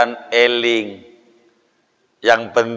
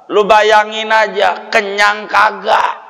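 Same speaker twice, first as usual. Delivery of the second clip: higher, slow, shouted challenge; mocking shouted reply to the hungry man; mocking retort; 6 semitones higher, slower than usual. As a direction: shout it slowly as a taunt. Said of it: "Kita jawab".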